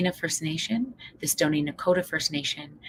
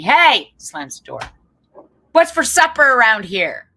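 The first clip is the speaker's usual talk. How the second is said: put-on rude kid; 6 semitones higher, 12 dB louder, slower than usual. Kid storms in rudely.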